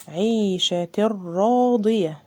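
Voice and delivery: عيشة راضية slow, the tanween merged into a doubled ra; slow tajweed chanting; slow recitation. Shown as a demonstration. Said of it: "مفيش غنة".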